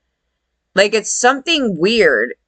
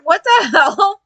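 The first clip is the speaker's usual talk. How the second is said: high laughing what the hell; cracking up in disbelief; 10 semitones higher, slower than usual. Real, not acted. Watching the show, laughing in disbelief.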